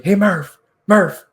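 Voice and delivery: high, loud call across the ice; retold yell. Veteran leaning over to shout at a teammate, loud call. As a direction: shout his name to grab his attention. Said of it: "like, yelling at Connor Murphy".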